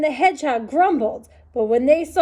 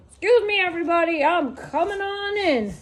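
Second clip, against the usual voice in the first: badger voice; 5 semitones higher than usual.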